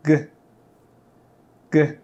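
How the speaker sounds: G said as a hard g at the back of the throat; G sound. Shown as a demonstration. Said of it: "pronounce the letter G".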